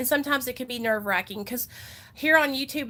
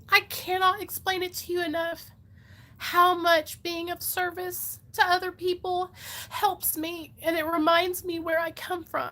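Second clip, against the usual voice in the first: tearful, higher-pitched sincerity; emotional; 6 semitones higher than usual. Real, not acted. Speaker telling viewers how service helps her, emotional. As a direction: push on through welling tears. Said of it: "I don't mean to get emotional".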